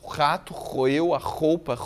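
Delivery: rato roeu roupa with a rasping R on each; slow, scraping the R like gnawing; stressed R sounds. Shown as a demonstration. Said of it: "Esse barulho é o barulho do rato roendo". (marcando o R como barulho de roer)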